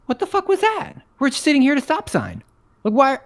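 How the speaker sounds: high-pitched, incredulous protest; retold indignation. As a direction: protest your innocence in disbelief. Retold indignant reaction to the finger.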